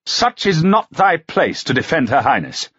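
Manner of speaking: indignant duke; snapping rebuke. A narrator's reading. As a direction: put the knight in his place. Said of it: "he snapped".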